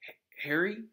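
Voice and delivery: hesitant, stumbling call of a name; uncertain. A narrator's reading. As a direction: call out unsure if he's awake. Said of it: "said Ron's voice uncertainly".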